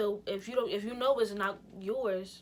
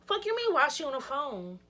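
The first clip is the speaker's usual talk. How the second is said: loud, raised-pitch angry challenge; indignant retort; 5 semitones higher, 5 dB louder than usual. Retold or imitated. Girlfriend challenging her man about the phone call, indignant.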